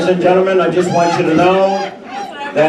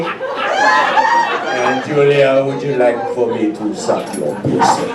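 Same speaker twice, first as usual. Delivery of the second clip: Jules voice; 6 semitones lower than usual. Acted. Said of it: "It goes something like this".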